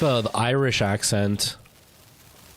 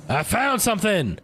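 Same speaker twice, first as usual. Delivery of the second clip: even higher, thrilled cry of discovery; more excited repeat; 11 semitones higher than usual. Real, not acted. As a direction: blurt it out, delighted.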